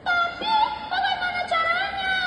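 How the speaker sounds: high-pitched, worried story question; wondering question. Acted. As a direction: wonder aloud, pitched well up. Character wonders how to help. (bertanya bingung dengan nada tinggi)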